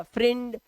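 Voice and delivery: "फ्रेंड" mispronounced as "frind", short i; wrong short-i vowel. Shown as a demonstration. Teacher shows learners what not to say, "फ्रेंड" as "frind". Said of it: "नहीं बोलते sir".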